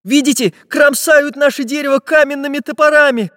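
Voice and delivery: high, agitated voice; frightened warning. A narrator's reading. Chizhik showing them the stone axes, frightened. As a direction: point out the danger in alarm. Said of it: "испуганно прервал их Чижик".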